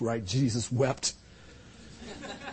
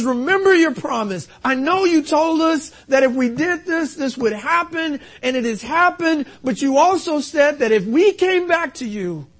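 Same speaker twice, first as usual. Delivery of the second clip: high, urgent preached plea; impassioned preaching; 10 semitones higher, 7 dB louder than usual. Acted. Nehemiah pleads that God remember his promise, impassioned preaching. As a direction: press the prayer home without letting up.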